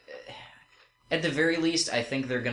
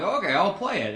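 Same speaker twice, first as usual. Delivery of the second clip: retold agreement; 9 semitones higher, 4 dB louder than usual. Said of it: "And I'm like".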